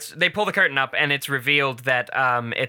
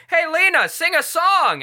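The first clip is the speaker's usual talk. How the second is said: calling out; 13 semitones higher, 4 dB louder than usual. Retold or imitated. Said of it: "the audience is like".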